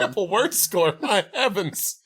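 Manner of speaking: high, hammed-up thrill over a tame game; mock scandalized Brit. Players at a naughty Scrabble night, mock scandalized. Joking Scrabble as British orgy.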